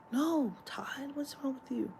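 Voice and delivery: hushed whisper, scolding Todd. Retold or imitated. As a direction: breathe it out like a disapproving secret. Girls scolding Todd, quoted.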